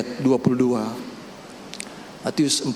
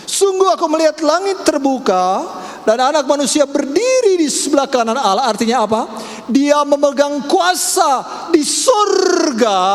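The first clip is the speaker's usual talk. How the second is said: high, loud preaching cry; preaching at full force; 10 semitones higher, 3 dB louder than usual. Acted. Preacher proclaiming to the church, loud and high. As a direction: proclaim it to the congregation.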